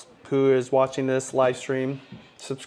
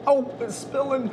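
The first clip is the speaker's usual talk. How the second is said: surprised; 11 semitones higher than usual. Real, not acted. Food spilling during thumbnail shot.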